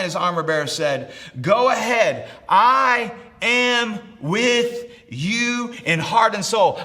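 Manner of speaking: rally the room with insistent force; full-force preaching. Acted. Preacher urging the congregation they are not alone, full-force preaching.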